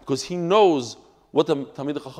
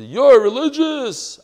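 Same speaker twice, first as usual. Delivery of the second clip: mock scream; 7 semitones higher, 8 dB louder, slower than usual. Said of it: "the woman started screaming at me".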